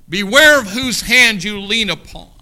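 loud preached repeat; loud warning. Shown as a demonstration. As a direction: call it out to the whole church.

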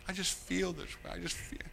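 mock soft whine. Imitating congregant again.

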